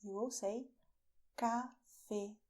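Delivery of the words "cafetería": cafetería broken syllable by syllable, long stressed í; Spanish way. Shown as a demonstration. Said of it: "Pronounce it the Spanish way".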